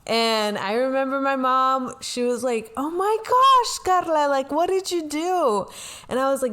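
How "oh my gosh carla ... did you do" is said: imitating mom, high, loud and panicky; panicked mom. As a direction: shriek it like a frantic mother. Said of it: "she was the one panicking".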